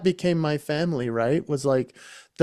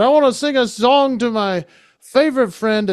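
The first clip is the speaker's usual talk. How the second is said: mock tipsy, higher and louder; fake drunk; 7 semitones higher, 5 dB louder than usual. Wacky roommate toasting the groom, mock drunk. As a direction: play it like a drunk wedding guest. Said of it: "I pretend I'm drunk".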